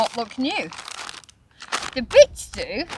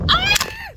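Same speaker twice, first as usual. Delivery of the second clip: startled shout. Real, not acted. Gust grabs the tent.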